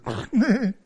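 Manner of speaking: a short, light mock giggle; imitated giggle. Preacher acting out Sara giggling in the tent, light mock giggle. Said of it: "Hun står og fniser inde".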